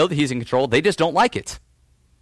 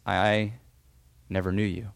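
softer, low and grave; solemn verdict; 5 semitones lower, 7 dB quieter than usual. Retold or imitated. Jesus turning away false followers, quiet and grave. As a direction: let it land quietly and finally.

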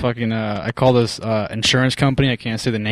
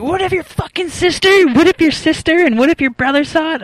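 shrill scandalized grandma; 6 dB louder than usual. Retold or imitated. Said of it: "she's like".

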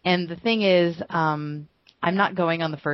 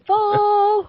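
excited gush; 6 dB louder than usual. Real, not acted. Gushing over her crush.

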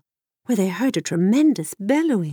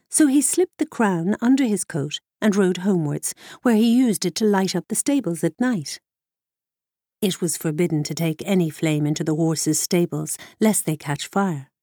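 ordinary delivery

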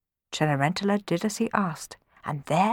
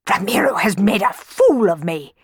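loud, spluttering outrage; indignant, put-on blustering voice; furious spluttering; 5 dB louder than usual. A narrator's reading. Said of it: "he spluttered".

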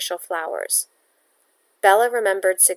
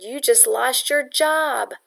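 slow grim news; 5 semitones higher, slower than usual. Acted. Pietro tells Bella the news.

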